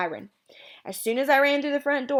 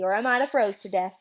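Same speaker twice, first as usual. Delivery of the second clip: ordinary delivery, nothing marked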